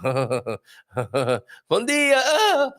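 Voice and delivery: high, laughing mock holler; really loud greeting. Greeting a hungover walker on the beach, mock loud. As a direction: call out a drawn-out, over-cheery greeting. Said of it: "You get a really loud bon dia".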